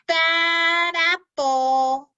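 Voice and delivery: drawn-out high, loud jeer; slow taunt. Acted. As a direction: stretch it out to sneer. Crab apples taunt Mac.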